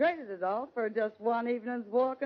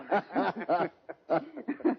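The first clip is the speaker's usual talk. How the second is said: a big throaty laugh at her own joke; hearty cackle; 3 semitones lower than usual. Acted. Lil laughing at her own saying with the cowboys, hearty. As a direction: laugh long at your own wit.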